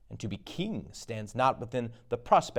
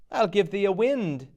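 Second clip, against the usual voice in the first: witch voice; 8 semitones higher, 4 dB louder than usual. Acted. Witch offers a wind.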